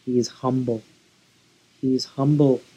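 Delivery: ordinary delivery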